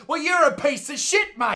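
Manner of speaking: high-pitched indignant outburst; indignant insult. Acted. Host scolding those who joke about dingoes, indignant insult. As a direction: snap back in offended outrage.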